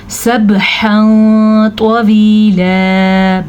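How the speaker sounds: सबहन तवीला chanted slowly with bounced b; सबहन तवीला, jolted b and drawn-out endings; qalqala on ba. Shown as a demonstration. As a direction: echo the b in सबहन तवीला, hold long vowels. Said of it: "बा के ऊपर कलकला".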